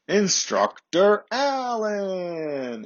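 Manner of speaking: high, long drawn-out call; mock scream-out. Class leader announcing the arriving instructor, drawn-out high mock scream. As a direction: holler the name like a roll call, stretching it. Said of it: "would scream out".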